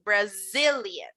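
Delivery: Brazilian with a long buzzing Z; strong Z. Shown as a demonstration. Teacher models Brazilian for students, leaning into the Z. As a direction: lean hard into the Z of Brazilian. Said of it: "Also remember to make this Z very powerful".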